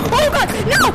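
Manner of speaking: panicked high yelling; losing balance. Real, not acted. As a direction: cry out as you lose your footing. Falling off plank in VR.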